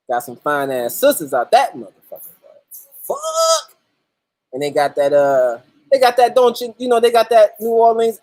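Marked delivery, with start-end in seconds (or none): 3.0-3.8 excited exclamation. Acted. Hyping fine New Orleans women.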